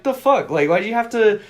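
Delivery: indignant disbelief. Retold or imitated. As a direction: scoff at the smug cousin. Retold outraged reaction.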